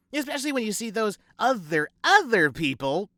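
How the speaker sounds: a hint of the preachy mother tutting; Gussie's mother lecturing the ladies, mock high matron; mock society mother. Mother voiced, emphatic pause.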